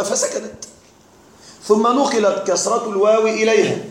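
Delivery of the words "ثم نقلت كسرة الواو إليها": ordinary talk, nothing marked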